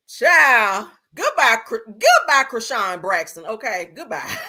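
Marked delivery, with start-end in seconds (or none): none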